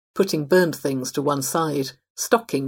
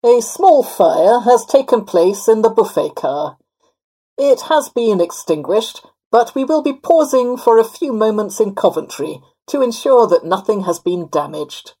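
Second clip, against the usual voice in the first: loud, higher-pitched official tannoy voice; projected public-address delivery; PA announcement; 6 semitones higher, 9 dB louder than usual. A narrator's reading. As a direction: announce it formally to all the passengers. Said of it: "over the P A system".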